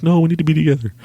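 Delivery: high, hammed-up insistence; mock desperate plea. Begging her after she tried breaking up, mock desperation. Acts out pleading against breakup.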